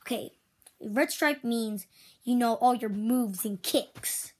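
ordinary delivery

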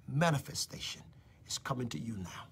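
whispered, hushed promise; prophetic whisper. Real, not acted. Prophet whispering a promise to a viewer. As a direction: drop to a secret whisper.

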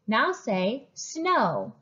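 slow phonics prompt to second graders; slow, clear teacher prompt. Shown as a demonstration. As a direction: say snow slowly and clearly. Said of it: "say another word".

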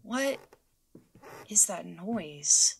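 frightened whisper, slow. Acted. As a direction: whisper it, afraid of being heard. Hears strange noise, frightened.

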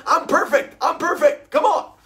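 high, loud, laughing mock bravado; mock self-assured. Friend mocking the swimmer who claims to be perfect, high and loud. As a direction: play the swimmer insisting all is fine. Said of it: "You're like".

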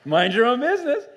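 high, loud, exaggeratedly cheery mock niceness; fake-nice with a smile. Shown as a demonstration. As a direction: force it through a big smile, much too loud. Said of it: "the smile on your face?".

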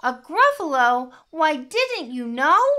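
high, loud mock astonishment; incredulous mouse. A narrator's reading. Mouse boasting to snake, high and incredulous. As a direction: lilt up high as the surprised mouse.

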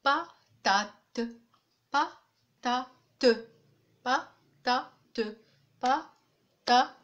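"patate" chopped into pa, ta, te; three separate syllables. Shown as a demonstration. Teacher demonstrating three syllables, each on its own beat. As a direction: pause after each syllable of "patate", final te sounded. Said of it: "il y a trois syllabes".

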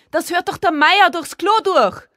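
high, outraged yell; crying out. A narrator's reading. Mother warns that the neighbour hears, scolding shout. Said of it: "rief sie".

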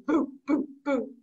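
short, separate little puffs; Baby Ghost boos. Retold or imitated. Baby Ghost joins the haunting, short boos. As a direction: puff out tiny ghost boos. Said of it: "Baby Ghost goes".

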